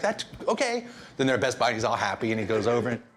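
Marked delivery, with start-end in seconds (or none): 0.0-0.9 put-on kid voice. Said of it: "And he's like".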